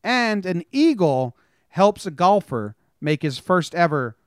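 slow, punchy newsreader delivery with dry jokes; news read. Acted. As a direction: read the headlines like a mock anchor, hitting each story. Reads news headlines.

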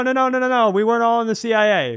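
usual speaking voice

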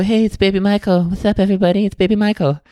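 high-pitched put-on baby voice; silly high celebrity impression. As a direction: imitate a childlike pop star in a high voice. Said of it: "the [name] theory of feedback".

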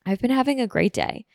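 normal talking voice

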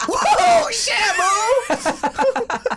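high, loud comic holler; hammed-up high-pitched whoop; mock alarmed shout. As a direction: shout it in exaggerated shock, breaking into laughter. Mock reaction to seeing own body.

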